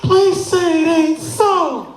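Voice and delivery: high-pitched, drawn-out wailing plea; hammed-up sobbing mother, begging slowly; mock crying. Mother begging the doctor after bad news, hammed-up crying. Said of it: "then she starts crying".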